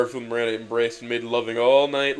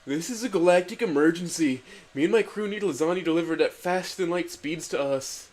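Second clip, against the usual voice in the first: invigorated declaration; 6 semitones higher than usual. Acted. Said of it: "declared Garfield with invigoration".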